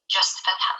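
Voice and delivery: normal talking voice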